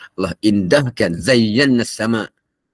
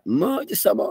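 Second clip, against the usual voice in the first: much higher cry of wonder; retold cry; 13 semitones higher than usual. Scholar marvelling at the night sky, high cry. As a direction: lift it high like calling to the sky.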